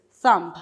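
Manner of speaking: a clear demo of the word thumb; thumb said clearly. Shown as a demonstration. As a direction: say thumb slowly and stress its final m sound. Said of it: "completely different".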